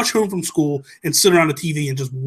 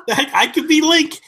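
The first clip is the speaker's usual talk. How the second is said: high mocking imitation of Toby, laughing; teasing a friend in a squeaky, giggly voice; 12 semitones higher, 3 dB louder than usual. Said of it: "So you can be like".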